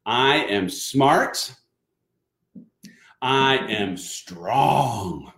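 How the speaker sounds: very slow, drawn-out affirmation lines. Shown as a demonstration. Dad closing the affirmation very slowly for the people in the back. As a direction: stretch each word like a grand finale. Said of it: "One more time for the people in the back".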